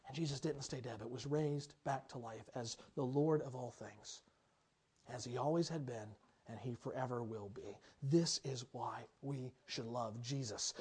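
quiet sincere preaching. Acted. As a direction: let it sink in gently. Soft, slow close of point.

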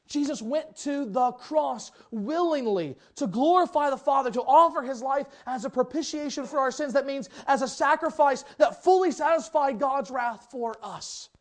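high, loud, driving sermon voice; full-force preaching. Acted. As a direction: preach it out to the whole hall.

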